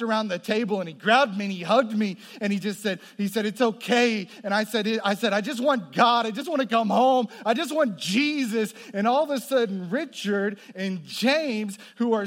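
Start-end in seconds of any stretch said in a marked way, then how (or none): 3.5-8.7 high and loud, fervent; emotional cry out. Retold or imitated. [name] sobbing to the stranger who hugged him, high and loud. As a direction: cry it out with longing. Tearful conversion moment retold.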